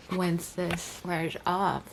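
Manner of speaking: ordinary talk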